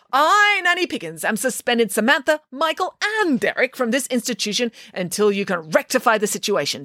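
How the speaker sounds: high, proclamation-style decree; grand declaration. A narrator's reading. Nanny Piggins proclaims the suspension, grand and high. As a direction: announce it like a royal decree. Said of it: "declared Nanny Piggins".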